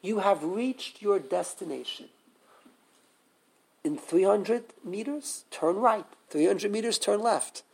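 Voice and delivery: softly spoken, lightly voiced navigation system; a hint of an automated voice giving directions; GPS voice. Teacher imitates a car GPS giving directions, lightly robotic navigation voice. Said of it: "you have a G P S".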